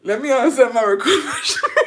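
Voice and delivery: loud, high, dissolving into laughter; cracking up. Real, not acted. Host trying to manage the recording, overcome by giggles. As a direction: try to speak and collapse laughing. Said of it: "I got the giggles".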